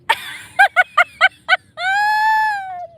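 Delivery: very high, loud shrieking laughter; screaming laugh. Real, not acted. Reading the eighty-mile range, screaming with laughter.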